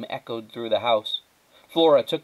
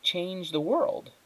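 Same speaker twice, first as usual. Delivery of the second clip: ordinary delivery, nothing marked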